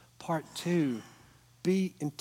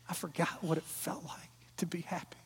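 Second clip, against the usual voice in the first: softer whispered confession; softly whispered; 2 semitones higher, 7 dB quieter than usual. Retold or imitated. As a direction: hush it like a secret. Wife's tender confession retold.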